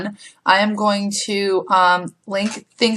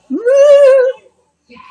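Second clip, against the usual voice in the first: high shrieking whoop; startled shriek; 7 dB louder than usual. Real, not acted. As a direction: let out a scared shriek at the spider. Spider on the wall, laughing.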